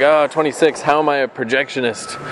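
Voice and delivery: normal talking voice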